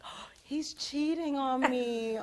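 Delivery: slow, high gasp of suspicion. Retold or imitated. Seeing her boyfriend grab his phone, a slow high gasp of suspicion. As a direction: gasp it like a sudden dark realization. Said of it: "So I'm like".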